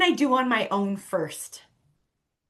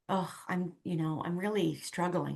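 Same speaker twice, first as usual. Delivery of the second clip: softer, lower, a touch downcast; retold struggling confession; 4 semitones lower, 9 dB quieter than usual. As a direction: quietly admit it, as a retold friend. Retold friend confesses she is struggling.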